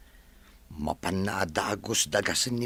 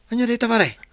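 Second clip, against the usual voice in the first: loud call; 11 semitones higher, 9 dB louder than usual. Acted. Friend calling out teasingly.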